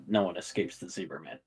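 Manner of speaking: soft, hushed and creepy; creepy whisper. Retold or imitated. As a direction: breathe the threat quietly. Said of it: "in a whispery, creepy voice".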